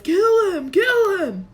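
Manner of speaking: high, loud yells; Voldemort yelling at Quirrell. A narrator's reading. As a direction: scream kill twice.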